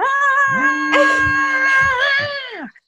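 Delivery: very high, loud, drawn-out singing scream; imitated rock wail. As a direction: wail a mock hard-rock high note. Imitating [name]'s Immigrant Song wail.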